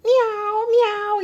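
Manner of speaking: high, drawn-out meows; cat meow. A narrator's reading. Storyteller imitates the farm cat, meowing high. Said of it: "hizo la gata".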